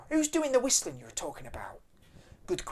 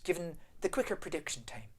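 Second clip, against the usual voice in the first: ordinary talk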